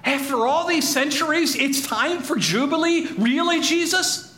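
high-pitched, incredulous questioning; stunned crowd, voice leaping up on each question; amazed disbelief. Acted. Crowd questions Jesus about Jubilee coming today, amazed disbelief.

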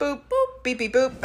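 high playful boops; imitated phone beeps. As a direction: beep it out like a phone.

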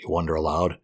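ordinary delivery

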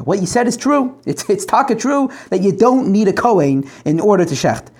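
usual speaking voice

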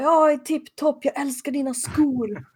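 high, slow giddy ditzy voice. Retold or imitated. Bo acting strange, retold. (hög, fnittrig konstig röst)

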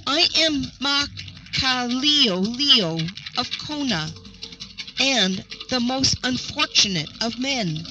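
plain reading voice

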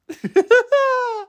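high, squealing laugh. Real, not acted. Cracking up at own metaphor, high laugh.

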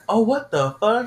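high-pitched astonished reaction; retold shock. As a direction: act out his stunned realization.

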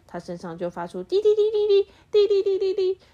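high loud beeping; beeping alarm. A narrator's reading. The vacuum's alarm going off, high loud beeps. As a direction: beep like a shrill alarm.

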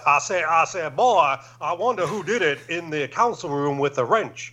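playful mock Southern accent mimicking Daniel Craig's detective; Foghorn Leghorn impression. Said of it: "his Foghorn Leghorn accent".

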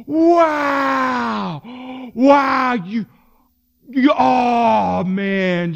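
breathless amazed wows; gushing with awe, voice cracking; awed gasps. Retold or imitated. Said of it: "people are like".

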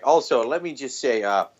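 ordinary delivery

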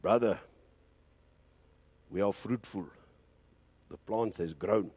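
put-on lightly deepened voice of a young prophet; deep-voiced friend. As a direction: drop the voice a touch for the friend's prophecy. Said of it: "He talks deep, he said".

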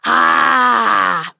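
huge loud roaring ah; dragon roar. A narrator's reading. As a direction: roar it with mouth wide open. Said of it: "opened wide and roared".

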